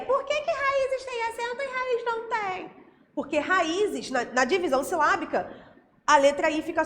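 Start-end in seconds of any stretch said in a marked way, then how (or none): 0.1-2.9 high-pitched, sing-song mock question; put-on high voice, playfully asking why. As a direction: pretend to quiz them in a much higher voice. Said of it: "se eu te perguntar por quê".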